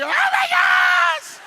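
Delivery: very high, loud, slow squealed gush; mock squeal. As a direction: squeal like seeing a long-lost friend. Imitating excited ladies.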